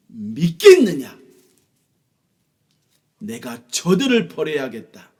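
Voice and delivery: loud, high stern divine voice; angry God rebuking Moses. Acted. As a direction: thunder down on a faithless people. God speaking in story.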